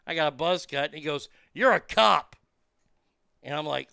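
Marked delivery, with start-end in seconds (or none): 1.5-2.4 louder, higher accusing jab in an odd voice. Retold or imitated. Patient accusing the buzz-cut guy, a louder, odd accusing voice. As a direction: point at him and accuse.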